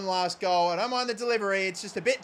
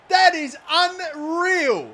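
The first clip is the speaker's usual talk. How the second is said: amazed exclamation; 7 semitones higher, 6 dB louder, much slower than usual. Real, not acted. Stunned at the goal.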